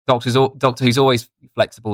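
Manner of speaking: ordinary talk, nothing marked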